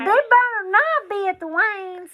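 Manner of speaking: high, slow, playful scolding; playful mom warning about glasses; mock stern. Real, not acted.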